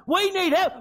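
ordinary talk, nothing marked

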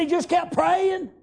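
high, slow shout on kept. Acted. A servant answering Jezebel again, now shouting. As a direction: bellow it out with preaching fire. Same reply repeated, shouted.

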